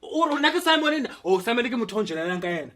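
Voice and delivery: high, loud accusing husband. Acted. Husband accusing wife of cheating with Simon, loud. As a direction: hurl the accusation about Simon. Improv marital quarrel.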